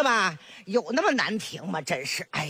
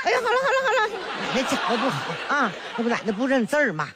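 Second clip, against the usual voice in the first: fast laughing excuse; 2 semitones higher, much faster than usual. Acted. Embarrassed grandma excuse.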